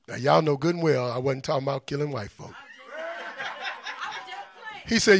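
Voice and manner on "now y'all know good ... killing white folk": low, soft retold excuse; hushed, lowered mock disclaimer; mock backpedaling. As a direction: imitate a speaker quietly walking it back. Mocks leader backing down to crowd.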